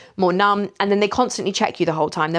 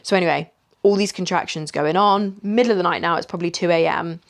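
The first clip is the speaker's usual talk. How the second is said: ordinary talk, nothing marked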